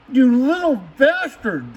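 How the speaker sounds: slow, strained curse at the metal; pained curse. Real, not acted. Cursing the sharp door part, pained and slow. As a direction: grit it out at the part that bit you.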